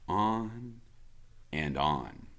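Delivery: ordinary delivery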